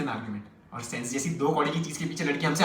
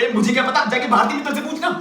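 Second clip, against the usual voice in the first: louder, high-pitched annoyed comeback; irate retort; 6 semitones higher, 5 dB louder than usual. Acted. Girlfriend retorting about the phone call, exasperated. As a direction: throw the question back in exasperation. Acted angry retort to caller.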